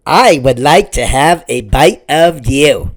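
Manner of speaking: a lightly voiced goat, greedy and polite; hungry goat voice. Gray goat to the matzo man, hungry goat voice. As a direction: ask it like a goat eyeing a snack. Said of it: "bleated the goat".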